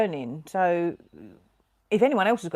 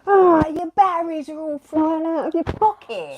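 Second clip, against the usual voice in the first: high, loud panicked warning; alarmed shriek; 11 semitones higher, 7 dB louder than usual. Retold or imitated. Wife re-enacting her own shout about the batteries, high and loud. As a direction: yell it in alarm. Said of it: "I mean".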